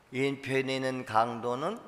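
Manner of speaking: usual speaking voice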